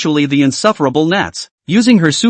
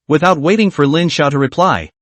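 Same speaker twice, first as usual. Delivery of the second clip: usual speaking voice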